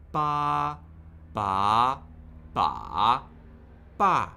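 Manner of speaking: emphatic "ba" in four pitch shapes; four Mandarin tones. Shown as a demonstration. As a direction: drill "ba" firmly in all four tones. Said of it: "warm up with a slightly different syllable".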